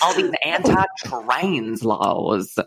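slow Southern drawl; put-on Southern grumble; Southern accent. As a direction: drag it out in mock Southern. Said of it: "Southern accents".

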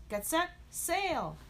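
high, drawn-out race start; starting call. Acted. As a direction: call the racers off, stretching the words.

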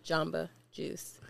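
slow, quiet "Jamba Juice" with a soft "ah"; repeated demonstration. Shown as a demonstration. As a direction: demonstrate "Jamba" slowly with an open first A. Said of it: "Say it again".

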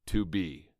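firmly stressed To be; emphasized. Shown as a demonstration. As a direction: give To be weight. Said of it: "if I just did both underlines".

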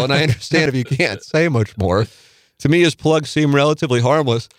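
reading aloud. Acted. Reading listener email aloud.

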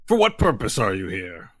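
lightly voiced lizard warrior questioning intruders; formal, falling questions in character; Brahmi. Lizard warrior asks intruders their business in the cave, formal and challenging. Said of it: "and he says".

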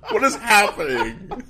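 high-pitched, laughing disbelief; incredulous. Retold or imitated. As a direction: drag out the question, high and baffled. Mock outrage at ad.

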